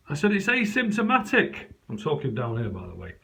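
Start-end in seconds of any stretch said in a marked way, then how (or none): 0.3-1.7 mock reply. His reply through the door.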